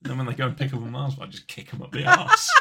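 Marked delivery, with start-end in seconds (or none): 1.3-2.5 much higher and louder, laughing. Real, not acted. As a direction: burst out laughing on the punchline. Laughing punchline.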